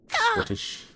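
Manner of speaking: tiny, very high squeak of a word; high falsetto squeak with a comic edge; squeaky Scottish. Acted. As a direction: squeak it like a cartoon mouse. Squeaky outburst after the voiced scene.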